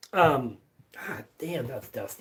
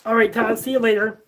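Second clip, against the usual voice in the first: louder, higher farewell call; calling out goodbye; 6 semitones higher, 6 dB louder, faster than usual. Real, not acted. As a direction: call it out cheerfully to someone leaving. Calling goodbye to departing viewer.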